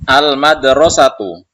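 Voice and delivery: calls the word out loud to start the drill; loud recitation. Shown as a demonstration. Teacher loudly leads the review drill. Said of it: "Kita ulangi lagi".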